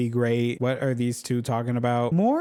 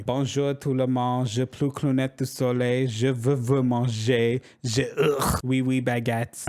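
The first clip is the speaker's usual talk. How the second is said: hammed-up French accent; mock French. Living her Emily in Paris fantasy in mock French. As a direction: play a silly tourist in Paris with a French accent. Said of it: "live my Emily in Paris fantasy".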